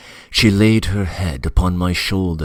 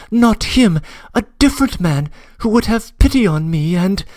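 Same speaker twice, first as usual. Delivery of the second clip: put-on higher young woman, earnest and hurt; a wounded girl insisting on her loyalty. Nastenka, doubting and faltering.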